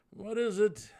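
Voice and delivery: Svirfneblin turns back, impatient and high. A narrator's reading. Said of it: "growing impatient".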